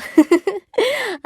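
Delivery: a high, amused cackle; high cackling laugh. Acted. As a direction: cackle at your own little joke. Laughs after "I do mean little.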